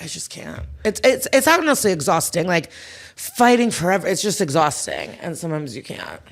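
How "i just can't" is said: defeated whisper. Retold or imitated. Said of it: "And sometimes I'm like".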